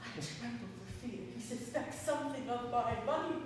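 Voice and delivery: low, hushed worry to himself; anxious aside. Acted. Miser fears servant suspects his money, hushed aside. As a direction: drop right down into a secretive aside.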